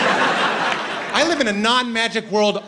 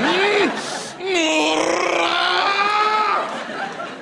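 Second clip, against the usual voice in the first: high shrieking laugh; 9 semitones higher than usual. Shown as a demonstration. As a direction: let out an uncontrollable squeal. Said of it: "you have to make this noise".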